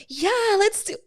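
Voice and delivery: high, overeager "yeah, let's do"; overexcited guy. Retold or imitated. Mocking a guy who's too much, shrill eager. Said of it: "if a guy's too much".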